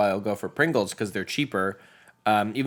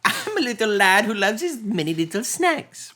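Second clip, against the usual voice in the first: put-on higher, cutesy little-boy voice; squeaky pretend little lad, higher pitch; tiny lad voice; 11 semitones higher than usual. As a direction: play a small kid who loves his snacks. Voicing a tiny snack-loving lad.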